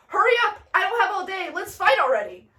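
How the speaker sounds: high, loud impatient demand; hammed-up exasperation; impatient challenge. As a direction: goad your opponent into fighting.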